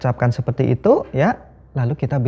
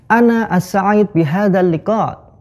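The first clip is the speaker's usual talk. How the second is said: higher, louder Arabic phrase with a lifted tune; Arabic rising intonation; 7 semitones higher, 5 dB louder, slower than usual. Shown as a demonstration.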